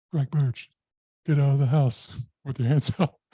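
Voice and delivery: imitated police loudspeaker call, high and loud; loudspeaker command. Police order man out of house, mock loudspeaker shout. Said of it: "they're on loudspeakers".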